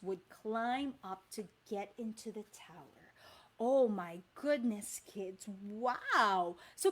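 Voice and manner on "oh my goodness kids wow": ordinary delivery, nothing marked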